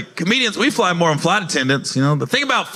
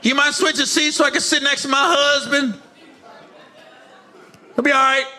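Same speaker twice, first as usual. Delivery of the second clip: higher, whiny pleading lady voice; pleading woman passenger; 8 semitones higher, slower than usual. Retold or imitated. Woman asking him to switch seats, high mock pleading. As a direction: beg like a wife wanting her husband's seat.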